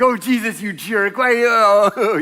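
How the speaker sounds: hammed-up prank-victim yelp; mock outburst. Prank victim yelling at Jesus, hammed-up exasperation. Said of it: "they're like".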